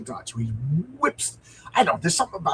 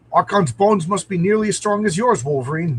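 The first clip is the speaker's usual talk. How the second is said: louder, higher, urgent comic-book line; urgent shouted report to Wolverine; hero's exclamation; 5 semitones higher, 8 dB louder than usual. Acted. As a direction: call it out over the fight. Comic character exclaims in battle.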